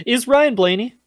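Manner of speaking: loud high-pitched title question. Shown as a demonstration. Suggests absurdly short title.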